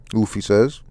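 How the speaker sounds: ordinary delivery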